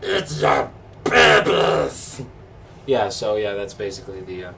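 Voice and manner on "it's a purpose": long, drawn-out Yeti roar; Yeti growl. Shown as a demonstration. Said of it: "the Yeti basically goes".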